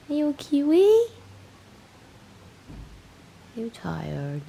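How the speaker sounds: high, loud squeaky pet voice. Real, not acted. Owner asking kitten its name, high loud coo.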